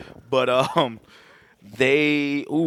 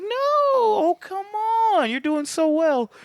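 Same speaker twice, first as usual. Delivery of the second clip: high-pitched teasing encouragement; mock coaxing. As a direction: coax them in a hammed-up, high voice. Voicing the killer coaxing the victim.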